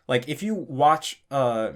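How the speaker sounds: usual speaking voice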